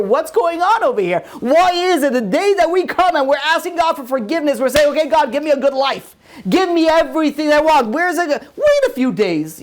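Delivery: high-pitched, urgent sermon delivery; fervent rabbi driving the point home; impassioned preaching. Real, not acted. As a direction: press the moral on the congregation. Preaches the moral, challenging listeners.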